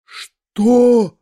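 stunned cry at the prince's news; shocked outcry. A narrator's reading. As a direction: gasp the words out in disbelief. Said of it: "вскричал я".

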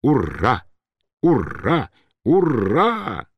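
rousing drawn-out hurrah, rising each time; shouted cheer. A narrator's reading. Turbin toasts the emperor, shouting hurrah. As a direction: cheer a toast, growing bigger. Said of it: "Турбин крикнул и поднял стакан".

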